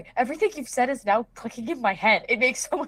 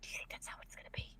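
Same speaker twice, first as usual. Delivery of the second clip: fast hushed whisper; 22 dB quieter, faster than usual. Acted. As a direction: whisper it quickly. Whispered question.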